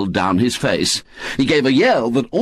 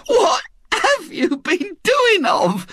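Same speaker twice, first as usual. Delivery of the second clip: much higher, slow trembling tearful question; quavering voice; slower than usual. Acted. Mrs Bott questions Aunt Jo, quavering. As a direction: ask through trembling fright. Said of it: "in a quavering voice".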